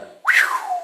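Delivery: very high whistled exhale of relief; acted-out "whew" after a close call; relieved whew. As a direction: let out a high, falling whew. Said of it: "when you come out of it, you're like".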